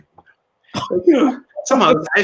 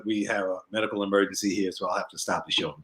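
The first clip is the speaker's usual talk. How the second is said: soft, lower, grave stage announcement; somber announcement; 5 semitones lower, 8 dB quieter than usual. Retold or imitated. Comic stopping the show for an emergency, soft and grave. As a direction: calmly halt the show. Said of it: "He just said".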